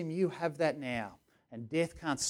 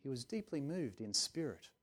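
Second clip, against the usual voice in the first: mild prim reading; 11 dB quieter than usual. Shown as a demonstration. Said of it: "it's a bit proper".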